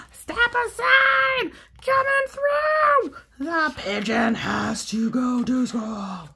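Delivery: high, loud, slow bird shouting; excited shout. Acted. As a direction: clear the way as Pigeon. Pigeon rushing for bus.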